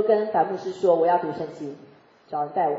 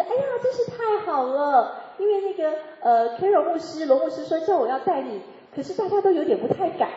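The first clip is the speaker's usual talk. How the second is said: delighted friend; 8 semitones higher than usual. Retold or imitated. Friend's relieved reply quoted.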